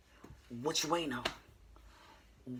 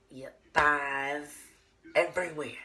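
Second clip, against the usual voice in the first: louder emphasis; 8 dB louder than usual. Acted. Escalating list.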